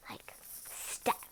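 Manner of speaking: whispered aside. Real, not acted. The reader whispering an aside to the characters. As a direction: hush them under your breath.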